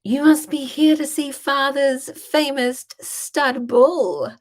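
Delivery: high, slow, loud mock-grand welcome; gushing hostess act. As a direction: present it with exaggerated delighted grandeur. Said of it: "I was like".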